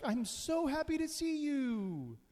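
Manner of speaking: very high, slow, gushing dog voice; happy dog. Pretend. Dog greeting its owner at the door, very high gushing voice. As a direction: gush like an overjoyed puppy at the door.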